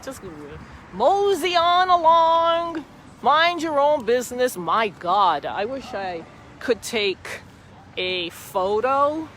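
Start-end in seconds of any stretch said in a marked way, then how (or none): 0.0-4.6 mock yell. Vlogger retelling yelling at an angry driver, loud mock yell. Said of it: "I yelled at him".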